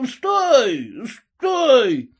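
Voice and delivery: высокий громкий пьяный крик; заори спьяну. A narrator's reading. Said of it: "заорал пьяным голосом". (пьяный крик)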